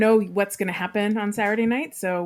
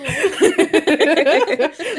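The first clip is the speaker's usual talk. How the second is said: loud laugh; 9 semitones higher, 6 dB louder than usual. Real, not acted. Big laugh at meme.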